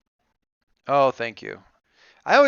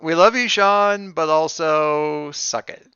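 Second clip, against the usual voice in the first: loud teasing; 4 semitones higher, 7 dB louder, slower than usual. Acted. Teasing a friend on stream.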